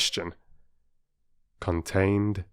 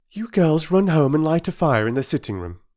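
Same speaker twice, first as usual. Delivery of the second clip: higher, brisk mother giving orders; 9 semitones higher, 4 dB louder than usual. A narrator's reading. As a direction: instruct the children as Mother. Mother voiced in story.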